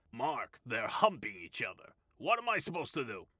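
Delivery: whiny boss. A narrator's reading. Boss excuses himself.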